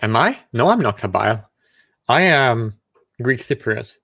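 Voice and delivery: ordinary talk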